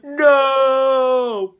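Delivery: long, high mock wail; hammed-up drawn-out cry of anguish; Darth Vader. Vader learns Padme is dead, mock-anguished wail. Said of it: "He goes like".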